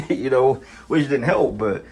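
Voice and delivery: ordinary delivery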